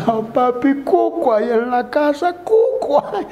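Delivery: high, mock-wailing small child; crying little boy. Four-year-old runs to papá about a bogeyman, high mock crying. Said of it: "Su hijo llorando".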